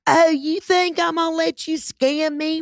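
high, drawn-out mocking outrage; hammed-up shrieky indignation. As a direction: caricature an outraged customer, high and slow. Mimics indignant women confronting the caller.

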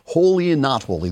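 normal talking voice

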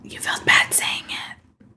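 soft unvoiced whisper, slowed down. Real, not acted. Teasing her co-host about feeling bad, in a whisper. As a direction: lean in and whisper the tease.

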